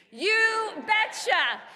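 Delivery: loud, high, drawn-out mocking cheer; very slow, shouted crowd chant; Tom Tiffany catchphrase. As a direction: belt out a borrowed catchphrase, stretching both words. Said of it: "as Tom Tiffany would say".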